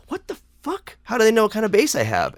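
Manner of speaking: high-pitched, stunned reaction; retold disbelief. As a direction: squeak it out in baffled disbelief. Recalls shock at border agents' knowledge.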